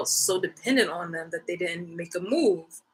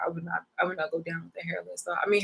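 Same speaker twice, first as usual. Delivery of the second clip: normal talking voice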